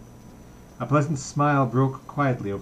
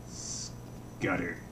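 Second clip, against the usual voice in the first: a thick, voiceless, much softer curse; thick cry; 4 semitones lower, 10 dB quieter than usual. Acted. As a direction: choke the word out thickly. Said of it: "he cried thickly".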